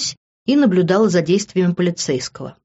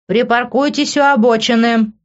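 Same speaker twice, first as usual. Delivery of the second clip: higher, projected command; raised, stretched roadside announcement; megaphone order; 6 semitones higher, 4 dB louder than usual. A narrator's reading. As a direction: call it out like a police loudhailer. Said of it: "голос в рупоре позади нас".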